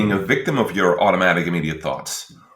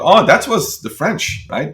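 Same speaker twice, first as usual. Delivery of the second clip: realization; 8 semitones higher, slower than usual. Retold or imitated. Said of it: "And I thought".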